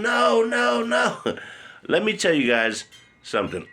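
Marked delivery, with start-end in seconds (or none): 0.0-1.2 put-on very high, pleading child's cry; terrified screaming. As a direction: beg in terror like a small boy. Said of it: "And I was screaming".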